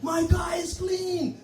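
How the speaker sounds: high, slow, stretched-out scream. Retold or imitated. Comic screaming at the man washing his windshield. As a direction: wail it at the guy through the locked window. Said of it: "I screamed".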